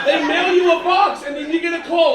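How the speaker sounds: oversell it like an ad; mock enthusiasm. Mocking the sales pitch.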